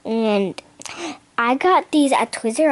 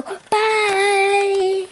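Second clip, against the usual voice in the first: squeaky bye; 9 semitones higher than usual. Pretend. Sign-off in toy voice.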